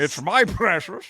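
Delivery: Gollum line in mock Scottish; Scottish star miscast as a creature; Sean Connery. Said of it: "he can never just not be Sean Connery".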